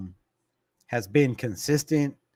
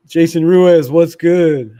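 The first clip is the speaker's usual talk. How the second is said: loud, upbeat call-out; hyped viewer shout-out; loud greeting; 2 semitones higher, 10 dB louder, slower than usual. Real, not acted. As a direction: greet him loudly across the room. Calling out a viewer.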